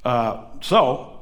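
ordinary delivery

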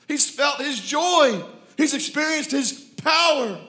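high, loud, building pulpit fervor; impassioned preaching. Real, not acted. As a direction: proclaim it with full force, punching key words. Preaches at full force.

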